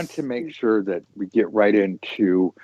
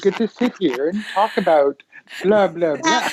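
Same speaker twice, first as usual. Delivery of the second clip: pitched-up, exaggerated sneer; mock snobbery; 8 semitones higher than usual. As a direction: mimic a show-off to tease a colleague. Mock boast teasing touring colleague.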